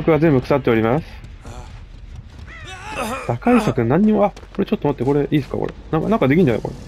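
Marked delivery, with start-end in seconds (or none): none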